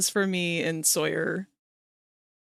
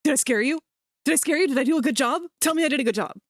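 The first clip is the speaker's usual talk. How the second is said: higher, louder eager pleading voice; eager mock animatronic; 4 semitones higher, 4 dB louder, much faster than usual. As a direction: play a needy animatronic begging praise. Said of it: "Springtrap's over here".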